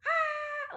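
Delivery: mother imitating her toddler's tantrum scream; banshee scream. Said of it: "like banshee screaming".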